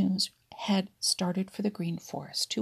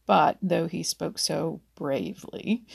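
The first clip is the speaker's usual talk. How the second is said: usual speaking voice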